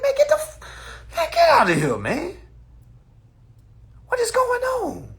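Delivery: high, loud mock exasperation; a squeaky "get out of here, man"; high exasperated disbelief. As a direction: brush him off in disbelief. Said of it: "I'm like".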